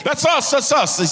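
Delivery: high, loud mocking voice; hammed-up gushing fan. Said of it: "They're like".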